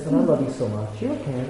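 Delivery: soft, gentle fatherly comfort; hushed and caring; retold tender reassurance. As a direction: quietly reassure a son, as retold. Father reassures his confessing son.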